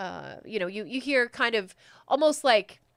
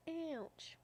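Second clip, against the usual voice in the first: whispered ouch; 9 semitones higher, 15 dB quieter than usual. Real, not acted.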